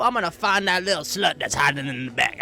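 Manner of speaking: high, loud jealous yelling; furious accusation. Retold or imitated. Jealous wife yelling at Corman about cheating, shrill and furious. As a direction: screech the accusation at him.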